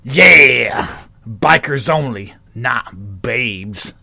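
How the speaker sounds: loud "Yeah" easing into lightly gruff biker. Acted. Second biker backing up the first at the bar, mildly gruff. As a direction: back up your mate as a biker. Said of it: "All bikers sound like that".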